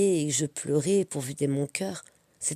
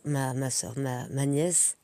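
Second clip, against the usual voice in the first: ordinary talk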